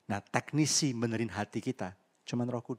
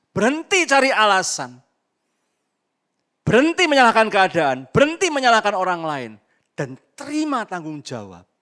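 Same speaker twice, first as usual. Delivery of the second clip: much louder, higher preaching command; forceful exhortation; 8 semitones higher, 13 dB louder than usual. Real, not acted. Preacher urging the congregation to stop making excuses, loud forceful command. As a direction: hammer each berhenti home.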